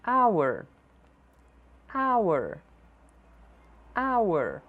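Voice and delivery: hour said awer, no h at all; silent h. Shown as a demonstration. Teacher models hour with silent h. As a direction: drop the h of hour. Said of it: "Nem sempre as consoantes".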